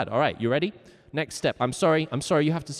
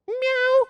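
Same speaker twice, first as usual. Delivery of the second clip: high, loud cat meow; imitation cat, way up high; 6 dB louder than usual. Shown as a demonstration. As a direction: give a high, insistent meow. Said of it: "You better be meowing".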